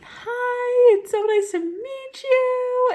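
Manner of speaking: put-on high, loud, gushing kids' hello; excited kids greeting. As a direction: squeal it like thrilled schoolkids meeting a new friend. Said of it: "they were all like".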